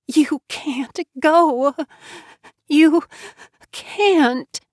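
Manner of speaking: distraught woman pleading with her dying friend; desperate grieving Jo Beth. Acted. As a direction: pour out grief and beg him to stay.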